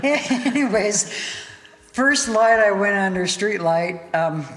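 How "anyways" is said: high, slow, amused anyways; laughing it off. Real, not acted. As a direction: shake off the laughter and move on. Moving on amid laughter.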